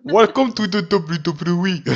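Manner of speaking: put-on slow, loud Russian villain accent. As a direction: boom it out in thick Russian. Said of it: "Pourquoi tu le fais avec l'accent russe". (accent russe lent et fort)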